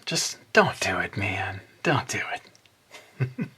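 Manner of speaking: whispered, drawn-out "don't do it"; mock warning whisper. Warning regular viewers not to comment, mock-ominous whisper.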